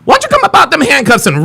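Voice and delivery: high taunting shout; taunting yell. Real, not acted. Taunting the arrestee, yelling.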